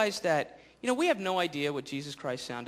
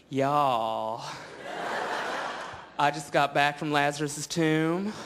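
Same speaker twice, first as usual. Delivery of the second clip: a lightly Southern, camp Jesus; effeminate Southern Jesus. Acted. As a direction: gossip about the tomb in a Southern drawl. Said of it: "like a really effeminate Southern guy".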